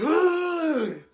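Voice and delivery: sharp high gasp for air; drowning gasp. Retold or imitated. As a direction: gasp like you're coming up from underwater. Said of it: "I'm like".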